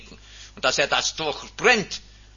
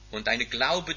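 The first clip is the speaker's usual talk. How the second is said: ordinary delivery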